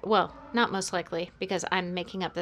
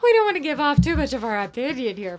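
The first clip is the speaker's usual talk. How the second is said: laughing; 3 semitones higher, 7 dB louder than usual. Real, not acted. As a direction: laugh while backing out. Laughing at her own blunder.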